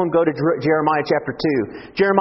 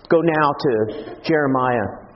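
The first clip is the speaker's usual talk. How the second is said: ordinary delivery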